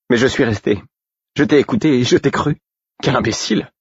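high, angry self-reproach; furious, pitched high; violent outburst. A narrator's reading. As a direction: hurl the words out violently. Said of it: "Elle jeta ces derniers mots avec tant de violence".

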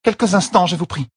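high, urgent plea that softens; pleading Elaine. A narrator's reading. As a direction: beg for a moment. Elaine begs for time.

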